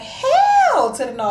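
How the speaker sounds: high, loud, drawn-out outburst; hammed-up indignant yell; emphatic refusal. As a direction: act out an outraged refusal with a long high first word. Acted emphatic refusal to mother.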